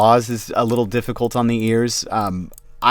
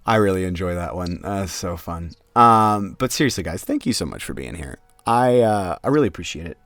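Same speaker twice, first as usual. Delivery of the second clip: ordinary talk, nothing marked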